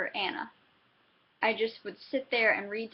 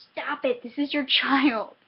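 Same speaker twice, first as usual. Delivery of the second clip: mock scolding; 4 semitones higher, 6 dB louder than usual. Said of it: "put the book down and go".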